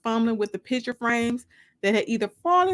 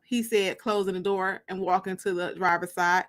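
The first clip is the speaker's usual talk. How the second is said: ordinary delivery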